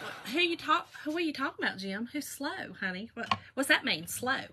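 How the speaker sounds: soft retold challenge; quietly indignant. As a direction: question him in hushed mock offence. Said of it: "Ooh, I was mad".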